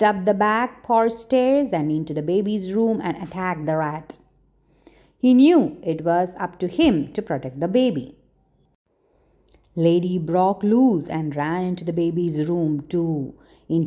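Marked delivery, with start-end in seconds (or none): none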